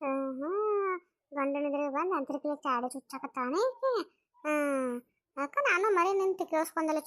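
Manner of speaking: a sneering elder muttering her scorn; mocking older woman.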